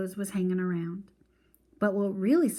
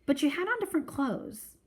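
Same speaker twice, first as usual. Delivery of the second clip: put-on higher, rising bewildered girl voice; little sister puzzled about his clothes; getting higher; 7 semitones higher than usual. A narrator's reading. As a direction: voice climbing in confusion. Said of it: "Joey's voice was getting higher".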